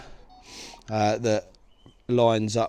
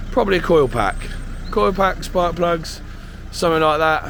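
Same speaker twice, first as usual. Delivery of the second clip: louder, talking over engine noise; raised voice; 2 semitones higher, 5 dB louder than usual. Real, not acted.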